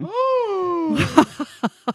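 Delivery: sharp loud gasp; shocked gasp. Real, not acted. Shocked gasp at swear law.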